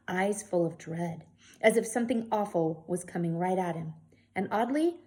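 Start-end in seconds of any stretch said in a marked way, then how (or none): 0.1-1.3 slow whisper leaning on eyes. Acted. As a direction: draw out eyes full of dread, whispered. Whispered reading, word repeated.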